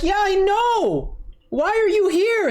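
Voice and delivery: exaggerated high sarcastic retelling; mocking himself. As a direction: squeak it out in exasperated mockery. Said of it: "I'm like".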